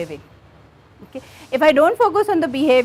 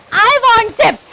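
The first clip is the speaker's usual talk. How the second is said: put-on higher, louder child wanting chips; whiny child; 8 semitones higher, 8 dB louder, slower than usual. Counsellor as the child, insisting on chips to the mother, higher and louder. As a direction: push the demand like a grumpy child. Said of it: "I'm your child".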